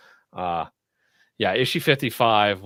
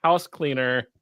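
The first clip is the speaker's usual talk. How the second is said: ordinary delivery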